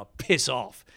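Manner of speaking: mock gruff brush-off. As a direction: toss off "piss off" as a cheeky joke. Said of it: "But it's kind of as a joke".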